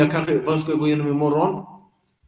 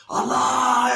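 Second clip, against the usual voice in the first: high, sustained call to Allah; mock crying out in prayer, held on one high note; demonstrated cry; 8 semitones higher than usual. Shown as a demonstration. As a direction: call it out high without real force. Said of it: "jag skriker".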